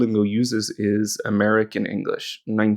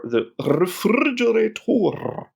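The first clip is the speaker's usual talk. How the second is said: drawn-out, higher, hammed-up "refrigerator"; mock-grand; 11 semitones higher, slower than usual. Teacher pokes fun at the app's word for fridge, mock-grand. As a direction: stretch out "refrigerator" in a swooping high voice.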